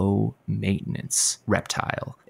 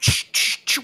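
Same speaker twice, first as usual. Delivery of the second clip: hushing. Acted. Hushing co-host off air.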